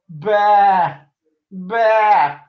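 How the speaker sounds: loud, high bleating baa; sheep bleat. Acted. Cub answering the gang with loud sheep bleats. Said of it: "Brother shouted back".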